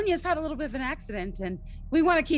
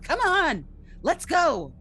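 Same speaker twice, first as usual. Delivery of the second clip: urgent call; 5 semitones higher, 3 dB louder, slower than usual. Retold or imitated. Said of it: "I'm like".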